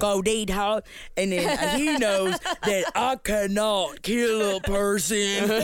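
slow, overdone fake Southern drawl; mock bad Southern accent. Mimicking the actor's fake Southern accent, slow. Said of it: "his fake Southern accent".

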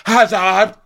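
high, loud, insistent calls; calling out. Retold or imitated. Lisa shouts Heather's name to stop her, mock insistent calling. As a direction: call the name repeatedly, rising each time.

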